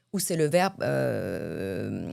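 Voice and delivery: ordinary talk, nothing marked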